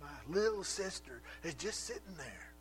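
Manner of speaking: a sister's put-upon, needling complaint; condescending. Acted. Martha complaining to Jesus, condescending and reproachful. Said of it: "condescending tone in her voice".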